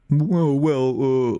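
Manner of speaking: stuck for an answer, mock floundering; mock stammering. Imitating a man asked about his daughter, stammering and stuck. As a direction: imitate someone freezing on a question. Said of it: "froze on so hard".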